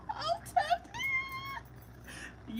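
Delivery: very high, drawn-out soft squeal; tearful squeal. Real, not acted. Reader amazed at her own gift, tearful high squeal. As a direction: squeak it out slowly, overcome. Overwhelmed by her accurate reading.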